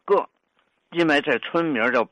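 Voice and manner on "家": 个 as short "ge" standing for jia; old village-name pronunciation. Shown as a demonstration. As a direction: say 个 lightly with a hard g. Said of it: "把家读成".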